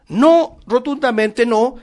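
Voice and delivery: forceful no. Real, not acted. Preacher rejects false prophecy.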